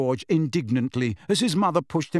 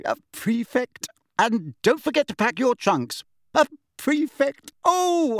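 flustered proud mother; 6 semitones higher than usual. Acted. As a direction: get all flustered and coo with pride.

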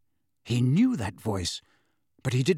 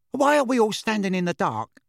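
put-on bright, higher young woman; lively young witch voice; young woman's voice; 7 semitones higher, 4 dB louder than usual. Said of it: "a third voice, ... a woman's".

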